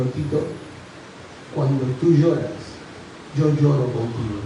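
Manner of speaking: low, soft, consoling voice with pauses; Dios. Retold or imitated. God comforting a crying teenager in his heart, low and gentle. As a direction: drop low and speak tenderly, unhurried. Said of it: "escuché la voz de Dios en mi corazón".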